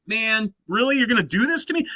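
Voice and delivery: half-joking dismay; mock disbelief. Singer imagining a band's reaction to the lineup, high and incredulous. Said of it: "It's like".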